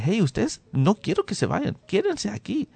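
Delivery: high calling out to stay; urgent high call to the family. Retold or imitated.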